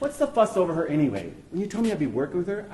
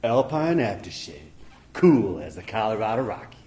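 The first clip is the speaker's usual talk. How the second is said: commercial pitchman; 7 semitones lower than usual. Acted. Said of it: "You mean the line from my commercial?".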